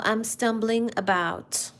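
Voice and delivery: ordinary delivery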